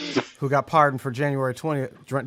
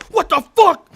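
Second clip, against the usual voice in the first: high, loud incredulous cop; mock cop shout; 9 dB louder than usual. Cop yelling at a man jumping into a Jeep, high and loud. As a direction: yell it like a shocked cop.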